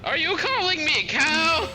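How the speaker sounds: mother. Retold or imitated. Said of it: "she be like".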